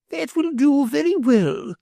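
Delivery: high, gracious noblewoman; gracious lady. A narrator's reading. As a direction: bestow approval grandly. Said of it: "said my lady graciously".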